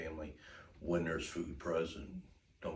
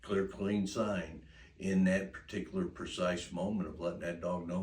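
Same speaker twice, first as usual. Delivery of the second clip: normal talking voice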